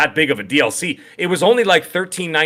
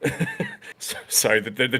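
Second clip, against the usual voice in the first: laughing; 2 semitones lower, 9 dB quieter, slower than usual. Real, not acted. Laughing at chat jokes.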